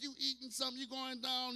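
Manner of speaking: ordinary talk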